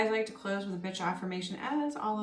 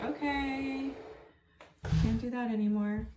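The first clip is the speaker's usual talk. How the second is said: mock resigned; 7 semitones higher than usual. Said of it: "So I'm like".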